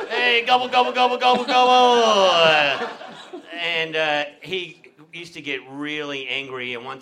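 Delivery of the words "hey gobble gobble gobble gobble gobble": higher, louder playground gobbling taunt; mock turkey gobble. Teasing the school bully, gobbling like a turkey. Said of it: "I used to say all the wild turkey slogans".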